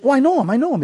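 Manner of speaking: high, retold excited protest; eager insistence. Friend told not to yell at the actor, eager insistence.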